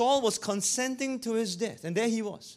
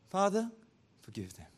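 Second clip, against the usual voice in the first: meek forgiveness. Acted. Jesus on the cross forgives instead of fretting, meek and calm. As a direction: let it sink into a gentle hush. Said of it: "how meek".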